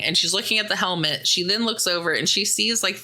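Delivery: normal talking voice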